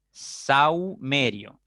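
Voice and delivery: "saumerio" drawn out slowly, AU kept as one syllable; slow syllable demo. Shown as a demonstration. Teacher shows students the silent H in saumerio, slow syllable demo. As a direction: stretch "saumerio" slowly, sau held together. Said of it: "Está la H metida entre la A y la U".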